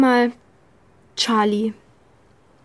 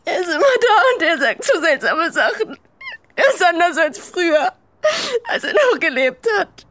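tearful, higher, voice breaking; crying; 5 semitones higher than usual. Acted. Alex crying as she tells Charlie about Benny. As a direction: push the words through sobs. Confessing the haunting voice.

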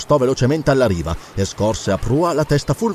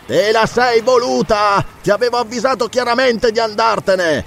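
high, stretched-out calling; indignant, raised-pitch shout; shouted retort; 9 semitones higher than usual. Acted. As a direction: call down the rebuke. Count shouts back from the fort.

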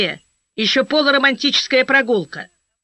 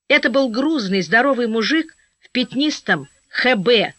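usual speaking voice

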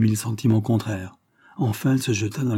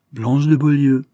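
raised, loud priestly summons; solemn address; 5 semitones higher, 7 dB louder than usual. A narrator's reading. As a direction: call the name with solemn authority. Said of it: "reprit le prêtre avec un accent solennel".

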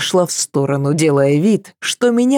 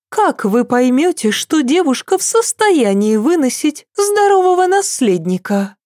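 much higher, curious princess; Cecile's voice; 7 semitones higher than usual. A narrator's reading. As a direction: ask it pitched high, eager. (голос Сесиль, высоко и с любопытством)

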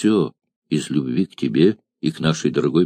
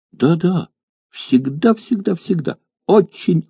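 put-on eager little girl; 9 semitones higher than usual. Child answers eagerly.

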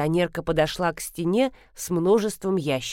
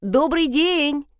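raised voice; 7 semitones higher, slower than usual. A narrator's reading. Customer greeting a dozing pharmacist, raised voice. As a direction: call it out slowly to someone not hearing. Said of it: "Пришлось повысить голос".

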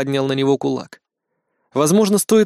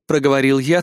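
ordinary delivery

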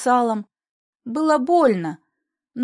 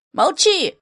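high, sudden squeal of command; angry shriek; 6 semitones higher, 3 dB louder than usual. A narrator's reading. Grandfather turning on his daughter to silence her, shrieking. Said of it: "взвизгнув".

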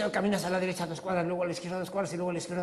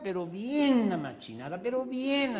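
ordinary talk, nothing marked

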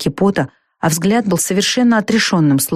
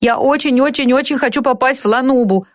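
put-on higher, pleading boy's voice; умоляющий мальчик; 7 semitones higher than usual. A narrator's reading. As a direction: plead eagerly like a nervous boy. Испуганный мальчик упрашивает взять его. (умоляющий мальчишеский голос, повыше)

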